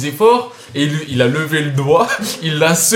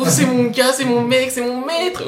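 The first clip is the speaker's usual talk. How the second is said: Kimimaro impression; 6 semitones higher, 4 dB quieter, slower than usual. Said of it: "il était là, Orochimaru".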